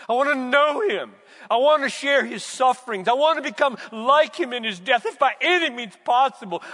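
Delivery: loud, high impassioned preaching; full-force pulpit climax; fervent preaching. Acted. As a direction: pour it out with longing.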